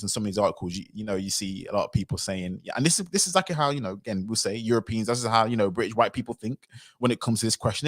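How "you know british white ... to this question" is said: ordinary delivery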